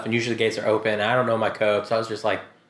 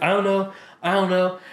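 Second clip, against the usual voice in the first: higher, flustered repeating; panicked; 5 semitones higher than usual. Retold or imitated. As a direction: mimic a panicked sister. Sisters panicking on phone.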